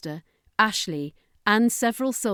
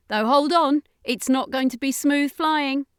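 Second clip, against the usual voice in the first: urgent alien warning; 8 semitones higher, 5 dB louder than usual. Acted. Danica voiced, warning.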